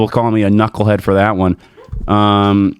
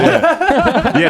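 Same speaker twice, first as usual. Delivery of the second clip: a high laughing yeah. Real, not acted. Cracking up at friend's joke, laughing yes. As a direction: laugh it out while agreeing.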